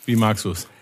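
ordinary talk, nothing marked

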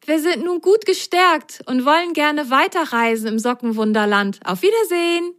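high, bright grateful thanks; high happy little voice. Acted. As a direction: thank the tree joyfully. Sock says goodbye.

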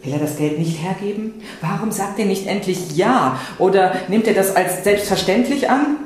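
ordinary delivery, nothing marked

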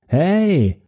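high shout after a fleeing woman; calling after her. A narrator's reading. Narrator shouting after the fleeing woman, high call. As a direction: call out sharply to stop her. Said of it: "rief ich ihr erneut nach".

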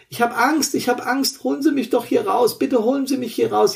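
high, panicked pleading; scared pleading. Acted. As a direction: beg to be pulled out. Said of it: "Die Perspektive des Verletzten".

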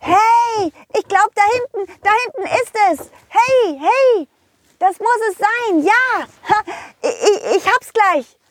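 put-on very high, excited child; shout-happy kid closing in on treasure, stammering; thrilled little pirate. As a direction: squeal it eagerly as a little boy.

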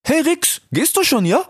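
high, cheeky youth voice; Ilias. Acted. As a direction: call out playfully, very high. (frecher Ilias, hoch und laut gerufen)

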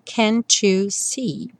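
can't you see said "cantchu see", slow; T becomes tch. Shown as a demonstration. Teacher models the tch linking, slowly. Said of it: "esse T virar tch".

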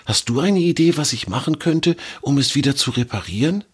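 high, anxious little squirrel voice; fretful squirrel asking a friend for advice; worried young squirrel. Acted. Squirrel Fridor asks for help.

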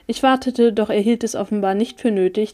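ordinary delivery

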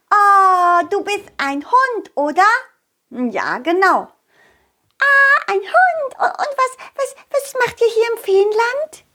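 high, bright little fairy voice; tiny fairy. Acted. Little fairy marvelling at the dog Balli. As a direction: squeak it out like a tiny curious fairy.

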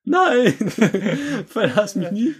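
high, overwrought plea, close to tears; dramatic pleading. Pretend. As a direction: beg like a spurned lover in a soap opera. Toaster begs, melodramatic.

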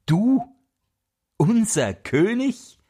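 high, indignant outcry of the birds; mock outrage at the little cheat; angrier birds. The birds shout at the wren in the mousehole, high and indignant. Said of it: "riefen die Vögel noch zorniger".

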